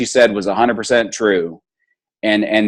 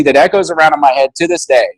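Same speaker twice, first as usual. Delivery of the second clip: normal talking voice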